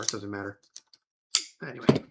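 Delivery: ordinary talk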